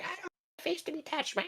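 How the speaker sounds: high, soft whiny princess; bratty princess voice. Retold or imitated. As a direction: squeak it out like a spoiled princess.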